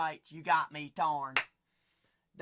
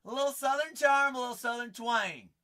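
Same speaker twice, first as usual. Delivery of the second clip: higher, louder southern twang easing off; 5 semitones higher, 5 dB louder than usual. Shown as a demonstration. Host defending his accent to viewers, southern twang. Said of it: "I like my southern accents".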